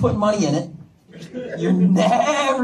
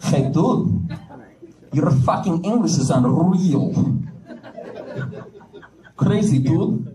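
Russian guy voice; 4 semitones lower, slower than usual. Retold or imitated. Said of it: "some Russian guy would be like".

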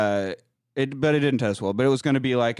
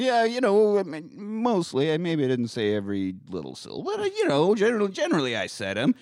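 sheepish excuse; 6 semitones higher than usual. Retold or imitated. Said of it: "He's like".

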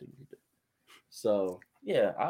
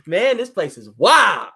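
higher, louder, slow fake excitement; fake casual; 7 semitones higher, 9 dB louder than usual. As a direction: hide the panic behind hype. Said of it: "this is a nine one one call".

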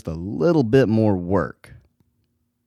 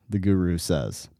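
ordinary talk, nothing marked

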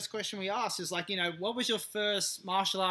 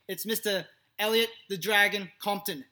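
ordinary talk, nothing marked

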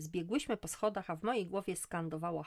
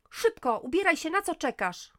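much higher, loud frantic yell; 11 semitones higher, 10 dB louder than usual. Acted. Mother yells at daughter to hurry. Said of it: "wrzasnęłam do Igi".

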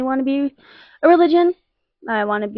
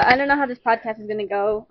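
ordinary delivery

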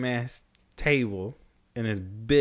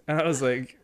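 ordinary delivery

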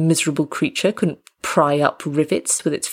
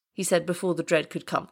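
plain reading voice

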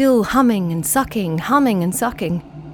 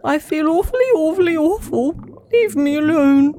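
lightly higher moaning fish voice; a hint of a queasy fish moan; moaning sick fish; 6 semitones higher than usual. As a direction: groan like a sick little fish.

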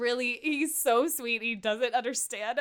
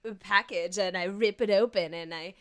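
Mike; 4 dB louder than usual. Retold or imitated. Man trying to role play for his partner, lightly put-on voice. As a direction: imitate a clumsy attempt at playing a part. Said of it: "When Mike's trying to be like".

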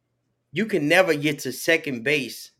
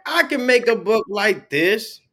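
higher, slower, wide-eyed declaration; excited realization; 7 semitones higher, 4 dB louder than usual. Retold or imitated. Author recalling reading a book, excited realization. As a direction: announce a discovery with growing confidence.

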